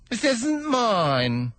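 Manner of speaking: high, slow stammering plea; timid hatter begging pardon; nervous hatter. Acted. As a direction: stammer it out, afraid of the king. Hatter answers.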